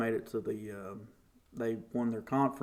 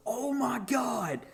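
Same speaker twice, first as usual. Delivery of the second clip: high, slow mock scream of disbelief; retold scream; 11 semitones higher, 3 dB quieter, slower than usual. Son hearing his name announced, squealing in disbelief. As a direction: squeal it, stretched out. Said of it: "I just screamed".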